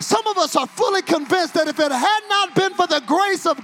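loud, high, sing-song preaching; whooping preacher at full volume; full-force preaching. Acted. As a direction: drive the crowd to praise.

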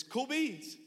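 a quick, offhand cool beans; awkward cool beans. Retold or imitated. Awkward young man to his crush, failing at cool. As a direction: toss it off trying to sound cool. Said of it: "But instead, what I said".

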